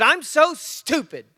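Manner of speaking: loud, slow, high cry of frustration; frustrated outburst. Retold or imitated. Daughter slamming her pencil down, frustrated outburst. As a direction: wail it out like a fed-up kid. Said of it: "slams her pencil down".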